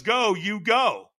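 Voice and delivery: sharp high "Go"; coach's command. Retold or imitated. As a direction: bark the word Go like a trainer. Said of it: "when he says".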